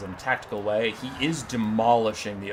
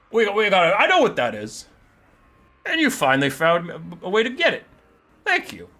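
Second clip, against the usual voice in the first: loud, high-swinging ogre; big ogre talking over someone; ogre character voice; 6 semitones higher, 4 dB louder than usual. Acted. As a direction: blurt it out as a pleased, oafish ogre. Ogre claims the map.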